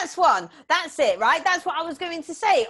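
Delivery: loud, high burst of recognition; excited recognition. Real, not acted. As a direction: seize on it loudly, that's the one. Phrase she forgot is supplied.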